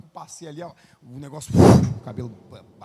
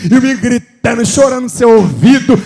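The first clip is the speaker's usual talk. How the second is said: full force preaching; 4 semitones higher, 14 dB louder than usual. Acted. Preacher to the church about children as God's heritage, in a loud building preaching cry. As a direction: pound the words out to the congregation.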